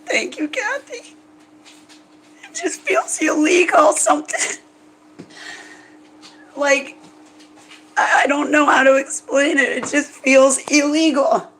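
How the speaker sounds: high, strained voice choked by tears; real sobbing, words squeezed out high; weeping. Real, not acted. Thanks friend while weeping.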